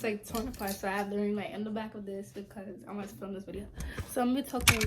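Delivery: ordinary talk, nothing marked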